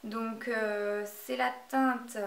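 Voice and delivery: ordinary talk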